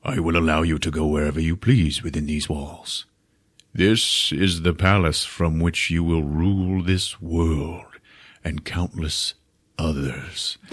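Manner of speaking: quiet, low regal beast. Retold or imitated. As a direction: rumble it like the Beast. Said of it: "and he's like".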